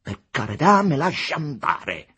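loud, high frantic plea; convulsed, agitated. A narrator's reading. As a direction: beg to be let go, worked up. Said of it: "gli rispose Aurelio Costa, convulso". (supplica convulsa e concitata)

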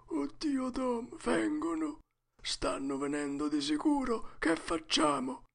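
put-on high, hushed, panicky boy; frightened. A narrator's reading. Huck thinks the ghosts are coming, frightened. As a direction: whimper it high, afraid of being heard.

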